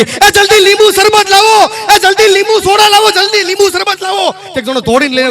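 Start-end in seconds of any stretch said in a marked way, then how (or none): 0.0-4.3 high, loud frantic yell for lemonade; calling out loud in a panic; old man shouting. Retold or imitated. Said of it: "તે બાબાએ રાડ નાખી".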